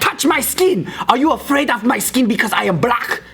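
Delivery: high-pitched, hammed-up challenge; confrontational. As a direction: imitate an offended woman demanding proof. Confrontational woman, retold.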